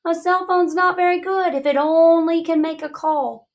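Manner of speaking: thinking voice. Acted. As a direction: voice the thought in your head. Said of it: "my thinking voice is saying".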